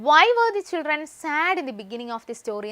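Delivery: teacher re-reading a question slowly. Shown as a demonstration. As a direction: dictate the question again, calmer. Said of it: "reading the exam question aloud".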